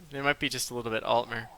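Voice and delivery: ordinary talk, nothing marked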